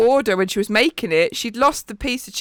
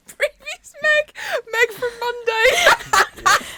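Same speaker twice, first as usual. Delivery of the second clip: laughing; 13 semitones higher, much slower than usual. Real, not acted. Teasing friend, giggling.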